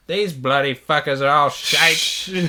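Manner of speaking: slow, drawn-out Australian imitation; Australian accent. YouTuber complaining about Japan, mock Australian accent. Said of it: "One guy's Australian".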